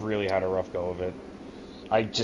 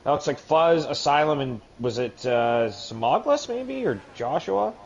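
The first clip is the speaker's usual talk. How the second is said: higher, louder live play-by-play; excited race call; 6 semitones higher, 5 dB louder than usual. Acted. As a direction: call the start with rising excitement. Calls a three-wide start.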